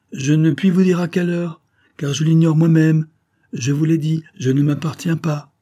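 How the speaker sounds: high, loud, urgent insistence; emphatic, agitated protest; insistent refusal. A narrator's reading. Heroine firmly refusing lover's plea.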